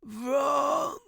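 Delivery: strained high choking croak; choked out. A narrator's reading. Dying professor to student, choked. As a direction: squeeze the word out, choking. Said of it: "he choked out".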